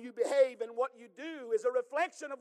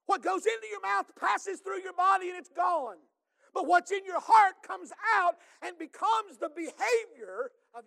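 rising, louder preaching voice that builds to a shout; full-force preaching; 7 semitones higher, 7 dB louder than usual. Acted. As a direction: preach it out to the congregation. Preaching Jesus' words.

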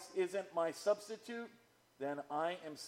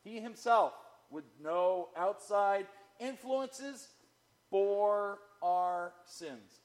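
"He himself" louder, "bore our sins" very slow; emphatic stress; 3 semitones higher, 3 dB louder, slower than usual. Shown as a demonstration. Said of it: "That's the key".

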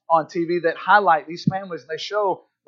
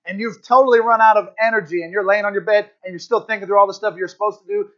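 ordinary talk

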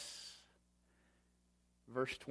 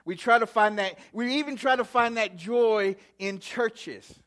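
higher, louder preaching cadence; 5 semitones higher, 7 dB louder than usual. Acted. Pastor warning the church about false joy, fervent preaching. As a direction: drive each "we try" home to the church.

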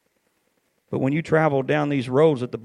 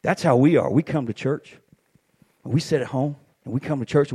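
normal talking voice

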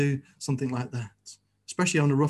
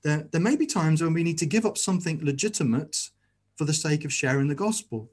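normal talking voice

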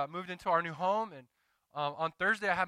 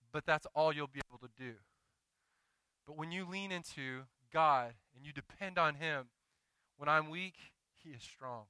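usual speaking voice

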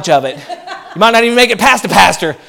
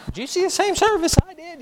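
higher, laughing disbelief; incredulous disbelief; 9 semitones higher, 7 dB quieter than usual. Retold or imitated. Pastor mocking a complainer's stunned disbelief. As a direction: laugh it out like you can't believe it. Pastor mocks gloomy churchgoer.

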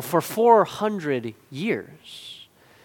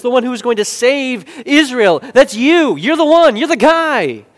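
loud, rising declaration that builds in excitement; bold proclamation; 5 semitones higher, 8 dB louder than usual. Acted. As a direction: proclaim it with mounting, overjoyed conviction. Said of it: "Peter speaks up with boldness".

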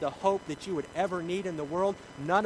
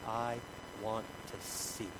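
low, slow, softly spaced words; quiet plea; 5 semitones lower, 8 dB quieter, slower than usual. Acted. Blind beggar answering Jesus, quietly pleading. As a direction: admit it softly and simply.

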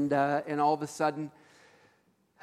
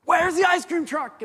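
put-on excited kid shout; 13 semitones higher, 9 dB louder than usual. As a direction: bellow it like a desperate kid. Same kid question repeated.

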